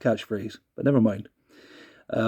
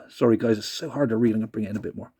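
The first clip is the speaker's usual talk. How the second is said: normal talking voice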